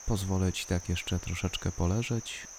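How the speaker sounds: ordinary talk, nothing marked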